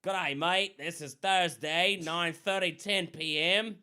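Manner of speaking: put-on loud, hammy Australian accent. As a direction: announce it like a bad Aussie. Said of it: "These are the worst accents you'll ever hear".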